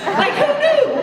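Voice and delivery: high, laughing who knew; a squeaky, giggling disbelief; mock amazement. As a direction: act stunned and amused. Mock amazement over the drink.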